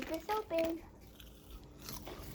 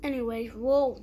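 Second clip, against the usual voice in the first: usual speaking voice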